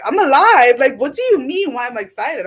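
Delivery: retold excited protest; excited retort. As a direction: exclaim it as a happy comeback. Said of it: "I'm excited. I'm happy".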